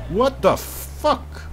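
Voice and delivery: ordinary talk, nothing marked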